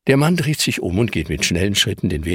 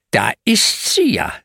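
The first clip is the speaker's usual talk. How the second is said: stern man's voice, higher and slow; slower than usual. Acted. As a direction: say it sternly, catching the culprit. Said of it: "sagt der streng".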